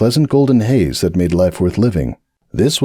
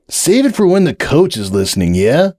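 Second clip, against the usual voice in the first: higher, brash party colt; cocky Hoops; 8 semitones higher than usual. Acted. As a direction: wave off the warning. Hoops brushes him off.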